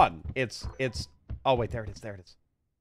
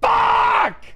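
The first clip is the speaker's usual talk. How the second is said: high, loud furious curse; frustrated shout; 6 dB louder than usual. Real, not acted. Gamer cursing a dropped item, angry shout. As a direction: blurt it out in rage. Drops the cookie again.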